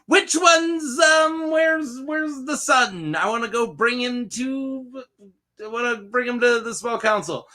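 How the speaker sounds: hammed-up high-pitched impatient king; imitated eager king. King looking for his son to bring to council, high imitation.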